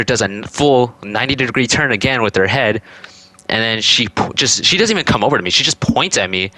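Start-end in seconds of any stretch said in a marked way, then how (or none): none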